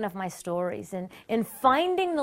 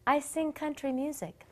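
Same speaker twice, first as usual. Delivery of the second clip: soft, higher little voice; sheepish fib; 5 semitones higher, 6 dB quieter than usual. Retold or imitated. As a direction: say it small and softly. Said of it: "I crossed my fingers".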